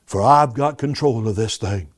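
God quietly reassuring his people; calm voice of God. Acted. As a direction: speak it gently as God comforting. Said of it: "God is saying to us right now".

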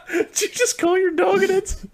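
high laughing disbelief. Real, not acted. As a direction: laugh through the question. Laughing at joke.

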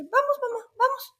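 put-on high, eager child voice pitched well up; high kid's "vamos, vamos"; little boy voice. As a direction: play the small son pulling mom along, high. Imitating little son's voice.

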